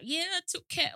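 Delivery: high, light posh voice. Retold or imitated. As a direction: lightly imitate a posh rich kid.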